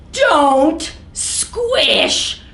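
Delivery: slow, pleading storybook refrain; drawn-out refrain. Acted. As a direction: plead it slowly and sweetly.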